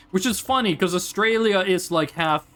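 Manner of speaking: usual speaking voice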